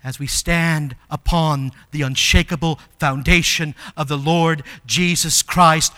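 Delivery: ordinary talk, nothing marked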